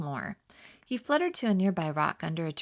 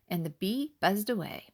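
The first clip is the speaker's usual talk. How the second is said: plain reading voice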